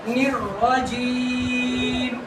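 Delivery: "الرجیم" with the last "ee" held long; drawn-out recitation. Shown as a demonstration. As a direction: slowly stretch "الرجیم" to show the length. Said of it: "کتنا کھینچا ہے".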